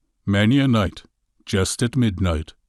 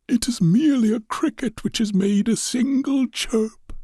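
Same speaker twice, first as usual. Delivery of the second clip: put-on high, thin old man's voice, trying to calm himself; an old man soothing his own fear; frightened old man; 14 semitones higher than usual. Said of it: "He had been saying to himself".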